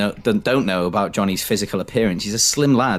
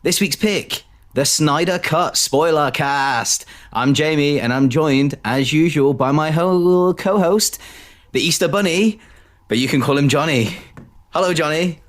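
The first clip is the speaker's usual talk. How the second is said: higher-pitched, drawn-out show opening; podcast intro; 7 semitones higher, slower than usual. Acted. Host welcoming listeners to episode nine, announcer style.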